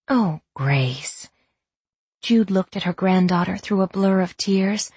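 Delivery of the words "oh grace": low, compassionate grandmother; tender consoling. A narrator's reading. Grandmother answers sobbing grandchild, low and tender. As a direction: drop the voice low to comfort a hurt child.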